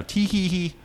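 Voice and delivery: high, put-on tee-hee; imitated giggle. Imitating the woman at the computer, mock high giggle. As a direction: mimic a silly giggle in a high voice. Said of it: "and she starts, you know".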